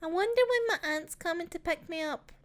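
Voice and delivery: put-on high, small child asking; a young girl wondering aloud; little girl voice. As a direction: voice the girl lightly and innocently. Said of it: "she goes".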